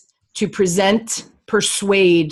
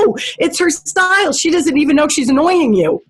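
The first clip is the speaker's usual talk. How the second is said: high, earnest explaining; retelling her own firm answer to the host; emphatic retold reply; 8 semitones higher than usual. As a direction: set her straight, pitched up and animated. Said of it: "I said".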